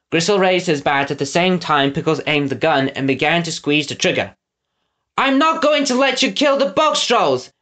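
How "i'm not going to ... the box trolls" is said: high, defiant call. Acted. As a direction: stand up to the villains in a high voice. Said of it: "Eggs called defiantly".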